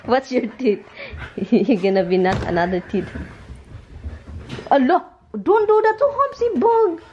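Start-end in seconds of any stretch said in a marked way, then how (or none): 4.7-7.0 much higher, squealing playful scold; high pet talk. Real, not acted. Owner playfully scolding the pet, very high pitched.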